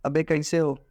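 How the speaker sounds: offhand, rough greeting; a casual blunt hello; casual guy's tone. Shown as a demonstration. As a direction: toss it off like a buddy. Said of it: "और अगर वही लड़के ने लिखा".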